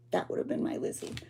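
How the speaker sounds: soft unvoiced whisper, moved. Real, not acted. Mother proud of her daughter, whispering. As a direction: breathe it out as a tender whisper.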